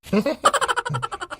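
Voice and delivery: sudden high burst of laughter; laughing. Acted. Husband laughs at wife's tearful plea, bursting laugh. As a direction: laugh out at her earnest plea. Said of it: "हसू नका तुम्ही [name]".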